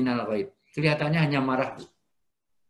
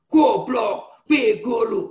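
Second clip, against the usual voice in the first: loud, high furious insult; angry yell; 7 semitones higher, 7 dB louder, much slower than usual. Retold or imitated. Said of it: "Loh, dekat kok teriak". (teriakan marah pura-pura)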